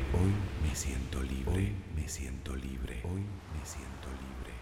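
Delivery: distant, very soft repeat of an affirmation; fading echo. Acted. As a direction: let each repeat recede further away.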